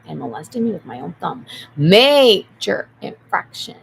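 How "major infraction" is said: "Major infraction" very loud and slow, stretched out; loud verdict. Real, not acted. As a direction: boom out "Major infraction" like a final ruling. Repeated louder verdict.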